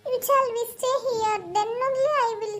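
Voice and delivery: put-on high, slow, pleading child; a small daughter begging her crying mother; sweet little girl pleading with mama. Child bargains with father.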